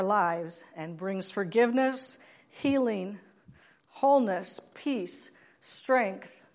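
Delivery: slow, with a pause between each item in the list. Shown as a demonstration. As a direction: spell it out slowly, item by item. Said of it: "say that again a little slower".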